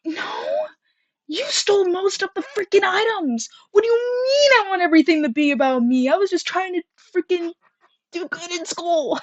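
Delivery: high, cracking outraged protest; indignant retort. Retold or imitated. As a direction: defend yourself, near tears with outrage. Her indignant retort to girl.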